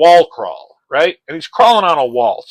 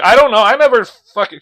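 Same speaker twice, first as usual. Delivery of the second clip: panicked prostitute; 8 semitones higher, 7 dB louder than usual. Retold or imitated. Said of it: "She's like".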